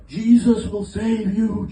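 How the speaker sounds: street preacher. Retold or imitated. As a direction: draw it out like a preacher on a corner. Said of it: "there was some guy preaching".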